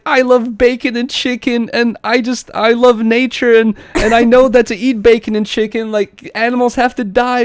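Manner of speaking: hammed-up upset kid voice; mock crying little girl. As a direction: imitate a kid having a meltdown.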